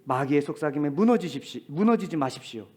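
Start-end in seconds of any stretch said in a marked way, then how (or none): none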